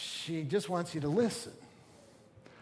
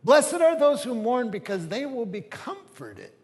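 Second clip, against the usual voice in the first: loud, high declaration of scripture; emphatic proclamation; 6 semitones higher, 10 dB louder than usual. Real, not acted. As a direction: proclaim it boldly, voice raised. Preacher proclaims the verse emphatically.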